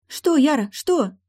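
high, loud worried cry; alarmed. Acted. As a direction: call out in sudden alarm. Friend startled by scream.

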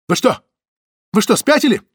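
high, loud shocked protest; woman startled when a bag lands on her; startled outcry. A narrator's reading. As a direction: jump at the sudden intrusion. Zoya startled by dumped bag.

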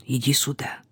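low, very soft, near-whispered summons; quiet call. A narrator's reading. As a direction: beckon her over under your breath. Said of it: "её тихо окликнули".

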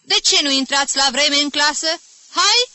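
loud, high furious teacher; scolding shout. Acted. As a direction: drive the latecomers in, yelling. (profesor furios, răstit și tare)

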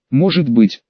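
usual speaking voice

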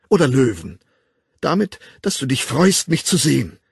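loud, exasperated complaint; increasingly annoyed. A narrator's reading. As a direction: talk over him in mounting irritation. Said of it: "Sie wurde immer ärgerlicher".

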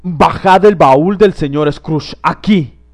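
slow, booming command; terrible shout. A narrator's reading. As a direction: bellow it across the hall. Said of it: "Una voz terrible gritó en el vestíbulo".

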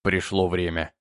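low, grave priest voice. A narrator's reading. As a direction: say it low and solemn. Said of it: "произнёс Тит низким голосом".

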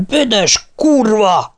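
high, drawn-out angry yell; bellowed curse. A narrator's reading. Martin curses Nancy over breaking glass, drawn-out yelling. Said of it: "Martin ordítozó káromkodását".

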